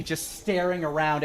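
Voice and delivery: ordinary delivery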